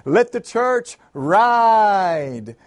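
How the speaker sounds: loud, very drawn-out preacher's cry; preacher shouting. A narrator's reading. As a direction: belt it out, holding the final word long. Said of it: "he started shouting ever louder".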